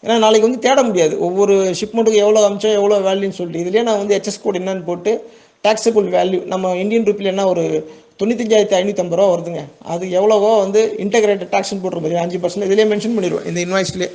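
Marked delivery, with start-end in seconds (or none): none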